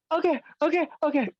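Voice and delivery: very high, fast, crying plea; whimpering beaten man. Pretend. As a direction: beg for mercy in a squeaky sob. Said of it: "he's like".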